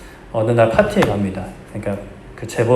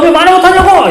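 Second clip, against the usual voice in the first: much higher, louder hammed-up drama yell; drama heroine shouting; 13 semitones higher, 9 dB louder than usual. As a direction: replay the drama hero's angry outburst, laughing through it. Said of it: "뿌리치면서 장면 기억하시죠?".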